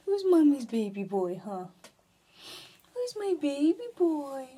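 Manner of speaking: talking to a dog. Real, not acted. Cooing to the puppy.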